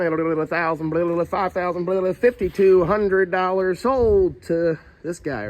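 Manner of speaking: a hint of a bidding auctioneer. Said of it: "That's my best auctioneer impression".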